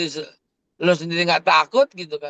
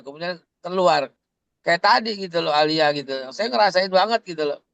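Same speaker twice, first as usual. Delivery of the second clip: normal talking voice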